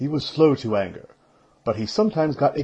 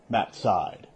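usual speaking voice

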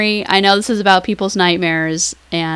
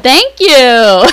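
loud, high thanks through real laughter; laughing; 7 semitones higher, 9 dB louder than usual. Real, not acted. Host accepting co-host's joking praise, laughing loudly. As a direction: laugh while saying it, big and raised.

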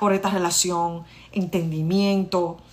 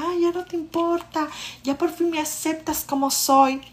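high, smug mock of the husband; a light parody of the smug husband; 9 semitones higher than usual. Wife imitating her smug husband's teasing, high and mocking.

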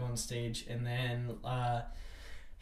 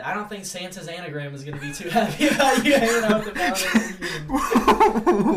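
laughing misquote; 4 semitones higher, 8 dB louder than usual. Retold or imitated. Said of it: "And I said".

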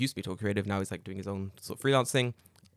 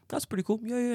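higher, sing-song echo of praise; mock impressed; 12 semitones higher, faster than usual. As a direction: parrot the compliment back brightly. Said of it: "I go".

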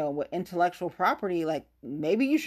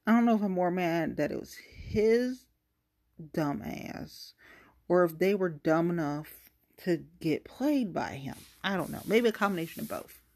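ordinary talk, nothing marked

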